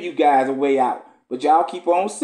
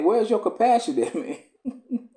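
amused, casual retelling ending in a laugh; laughing paraphrase; 7 semitones higher, slower than usual. Retold or imitated. Preacher paraphrasing Esdras to God, laughing. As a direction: toss off the prophet's plea with a chuckle. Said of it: "He's saying".

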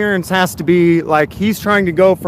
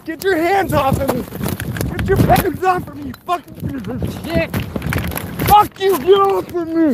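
high frantic shouting; panicked yelling; 6 semitones higher than usual. Real, not acted. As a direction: yell in real panic. Being grabbed by officers.

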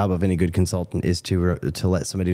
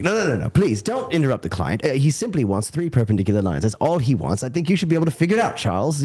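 higher, fast, pushy manager voice; pushy project manager; 6 semitones higher than usual. Acted. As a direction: brush off the expert, sped up. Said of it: "Great voice, by the way".

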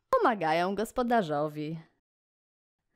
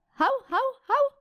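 high yappy bark; dog barking; 4 dB louder than usual. Acted. Reading the poem to kids, a high mock dog bark. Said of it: "Piesek szczeka".

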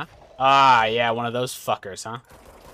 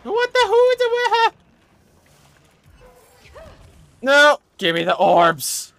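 high shrieks of real panic; panicked shrieking; 11 dB louder than usual. Real, not acted. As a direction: scream in sudden terror. Jump scare, streamer panics.

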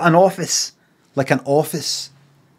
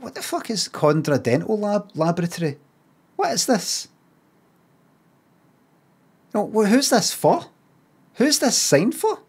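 rising, incredulous questions at a sign; mock bafflement; 8 semitones higher than usual. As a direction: act baffled by the sign, pitch climbing. Mock puzzlement at a shop sign.

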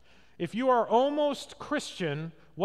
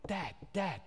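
soft, repeated call, lightly imitating a child; a hint of a kid calling in the night; little kid calling; 4 semitones lower, 8 dB quieter than usual. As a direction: quietly pester a sleeping Dad like a small child. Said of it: "your little kid says".

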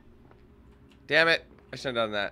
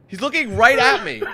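panicked laugh; 11 semitones higher, 7 dB louder than usual. Real, not acted. Killer staring at hiding player.

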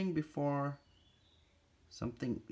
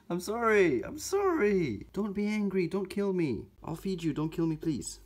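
high, louder half-laughing begging; high playful pleading to an angry tarantula; mock pleading; 7 semitones higher, 4 dB louder than usual. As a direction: beg the angry spider for mercy, playfully.

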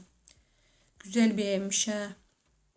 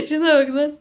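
higher, louder through laughter; laughing; 4 semitones higher, 5 dB louder than usual. Real, not acted. Laughing to viewers at the strange fridge. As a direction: still laughing as you point it out. Reacting to a silly room layout.

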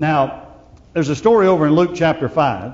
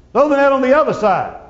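higher and louder holler across the water; 9 semitones higher, 5 dB louder than usual. Retold or imitated. The Lord calling from the seashore to the fishermen, loud holler. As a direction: call out to the boat from the shore. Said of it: "he hollers out".

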